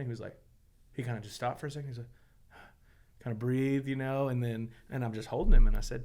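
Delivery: ordinary talk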